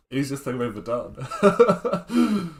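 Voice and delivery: slow, rising into a loud high laugh; jokey, laughing boast. Real, not acted. Streamer joking about an easy win, laughing boast. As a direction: stretch it out and break into laughter.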